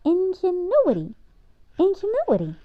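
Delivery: put-on a young girl tasting a new word, said twice; child savoring a word. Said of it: "she repeated".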